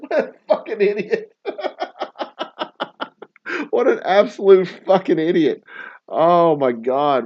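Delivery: high, laughing disbelief; laughing insult. Real, not acted. Mocking the suspect, laughing hard. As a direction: laugh it out at the idiot.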